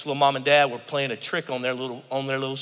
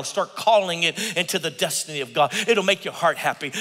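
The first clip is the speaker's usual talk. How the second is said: ordinary talk, nothing marked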